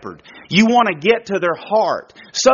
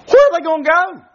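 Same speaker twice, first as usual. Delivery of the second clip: incredulous; 10 semitones higher, 3 dB louder, faster than usual. Real, not acted. Preacher mocking runaway threat.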